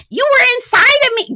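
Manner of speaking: high mock yell. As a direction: yell it across the table in mock. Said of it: "the way you yelled out".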